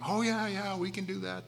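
a hint of keen followers saying yes; mock-eager yes from the disciples. Disciples eagerly claiming they can.

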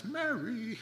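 MARY in a high, drawn-out lilt; different inflection. Shown as a demonstration. Preacher telling apart friends with one name, lilting MARY high. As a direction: sing out MARY high and soft, stretching both syllables. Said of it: "use different inflection in your voice".

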